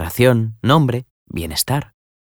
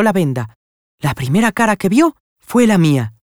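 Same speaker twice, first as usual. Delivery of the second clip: put-on higher, bright-eyed young woman coaxing a friend; eager young lady; 10 semitones higher than usual. A narrator's reading. Young woman coaxing her friend to come see Pablo, eagerly. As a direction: Florentina pressing Nela to come see Pablo, high eager voice. Florentina urging Nela, high voice.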